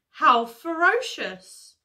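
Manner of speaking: loud, high, drawn-out question; lightly acted storybook alarm; alarmed lion. A narrator's reading. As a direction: stretch it out in worried surprise. Alarmed lion asks about beasts.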